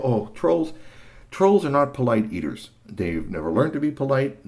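usual speaking voice

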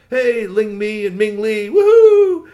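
high, loud, whooping hello; drawn-out, high-pitched cheer of welcome; excited greeting. Acted. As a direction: call out with glee, rising to a whoop. Greeting kids home from school.

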